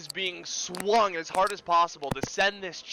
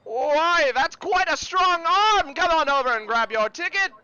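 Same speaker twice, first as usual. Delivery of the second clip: carnival barker; 10 semitones higher, 8 dB louder than usual. Acted. Carny hailing a strong player's winning hit, booming showman's call. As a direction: marvel grandly for the crowd to hear.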